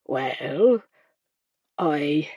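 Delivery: low, hesitant doctor with long pauses; falter, searching for the words. A narrator's reading. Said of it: "The doctor faltered".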